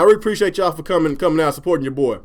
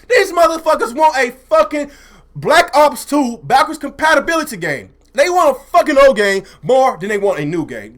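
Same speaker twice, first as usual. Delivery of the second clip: high-pitched, heated complaint; heated rant; 7 semitones higher, 4 dB louder than usual. Real, not acted. Streamer fed up with what gamers ask for, heated. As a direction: rant with rising pitch and punch. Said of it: "I'm a little heated".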